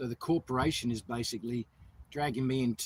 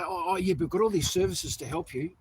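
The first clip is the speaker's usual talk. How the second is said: higher, louder mock-helpful voice; mocking officials; 5 semitones higher, 5 dB louder than usual. Homeless man mimicking officials offering services, mock-helpful. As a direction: imitate officials pushing their help.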